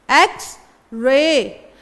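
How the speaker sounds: एक्स रे very slow, eks spelt out clearly; slow model word. Shown as a demonstration. To students, a teacher slowly spells out एक्स रे. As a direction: draw out एक्स before रे. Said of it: "आई का sound आएगा".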